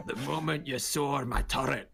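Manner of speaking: put-on Scottish dwarf voice; hammed-up Scottish brogue; Scottish accent. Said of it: "I don't know why he's Scottish".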